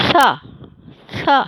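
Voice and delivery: "سا" said twice, tongue tip against the front teeth; demonstrated letter sound. Shown as a demonstration. Teacher modelling the tongue position for learners, careful "سا". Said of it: "سامنے زبان کی نوک کو رکھیے اور پھر پڑھیے".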